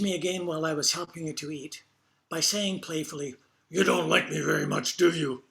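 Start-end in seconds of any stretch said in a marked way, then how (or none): 3.7-5.4 put-on gruff, slurred old woman teasing. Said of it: "in her gruff, almost slurred voice".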